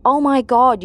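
retold shocked cry. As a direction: narrator lightly acting out a shocked witness. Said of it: "hears a female voice from the speedboat".

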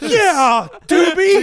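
high, loud mock stoner cry; stoner dude shouting. One stoner buyer to another, hammed-up loud lament. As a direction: call it out high, rising at the end.

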